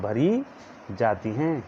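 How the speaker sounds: spaced out word by word; slow dictation. Shown as a demonstration. Teacher dictating notes to students, slowly word by word. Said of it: "मैं धीरे धीरे बोल रहा हूँ".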